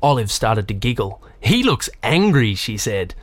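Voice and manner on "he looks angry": put-on higher, drawn-out little girl's observation; young girl's line. A narrator's reading. Olive giggling at the cop from the car, lightly voiced girl. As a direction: say it slowly, pitched up, like an amused kid.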